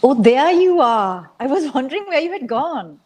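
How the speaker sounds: a mother greeting her son at lunch; mother's voice. A narrator's reading.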